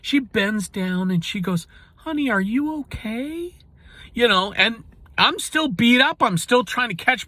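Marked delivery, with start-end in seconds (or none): 2.0-3.6 high, soft and gentle, a doting grandma; sweet grandma voice. Retold or imitated. Grandma bending over her hurt grandson, high and tender. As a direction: coo it like a sweet little old lady checking on you. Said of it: "she's that sweet little lady that I love".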